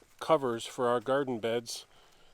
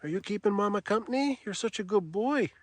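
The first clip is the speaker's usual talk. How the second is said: talking to a dog; 6 semitones higher than usual. Real, not acted. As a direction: coo praise to the pup. Praising the dog.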